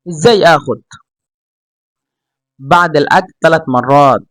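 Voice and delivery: model dialogue. Shown as a demonstration. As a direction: read it out as a model for learners to copy. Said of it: "চেষ্টা করবেন আমার মতো করে উচ্চারণ করতে". (ধীরে ধীরে আদর্শ উচ্চারণে সংলাপ)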